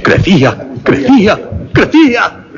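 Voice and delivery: crecía building from flat to a high strained cry; building frenzy. A narrator's reading. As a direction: let the panic climb word by word. Narrator voices mounting panic.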